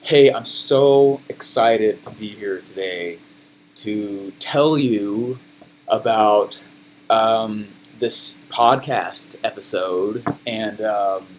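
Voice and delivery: low, very slow, soft monotone; garbage low-energy delivery. Retold or imitated. Speaker imitating his earliest podcast episodes, dull low-energy drone. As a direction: drag it out lifelessly with halting ums. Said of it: "My delivery was garbage".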